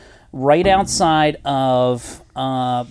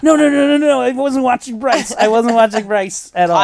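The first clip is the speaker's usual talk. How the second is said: mock panicked denial; 8 semitones higher than usual. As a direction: imitate someone caught out, denying frantically.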